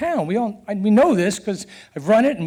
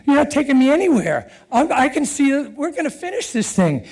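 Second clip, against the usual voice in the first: higher, stubborn retort; defiant refusal; 6 semitones higher than usual. Retold or imitated. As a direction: refuse flat out, raised pitch. Defying the bullhorn official.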